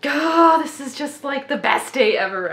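excited outburst, loud at the start; overexcited. Real, not acted. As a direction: burst out, overwhelmed with delight. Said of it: "I'm so excited".

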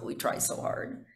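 ordinary delivery

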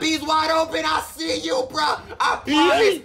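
high, loud excited yelling; excited shout. Real, not acted. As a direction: shout at the open man. Cheering an open receiver.

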